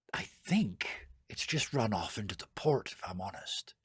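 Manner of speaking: near-whispered admission; soft, slow, breath-only aside; hushed confiding. Acted. Wife confides dog likely ran off.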